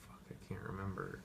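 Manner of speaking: whispered frustrated aside; hushed annoyed whisper; muttered curse. Real, not acted. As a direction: curse it under your breath.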